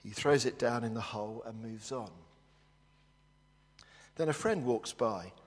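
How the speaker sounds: ordinary delivery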